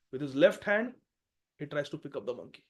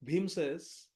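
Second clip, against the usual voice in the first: ordinary talk, nothing marked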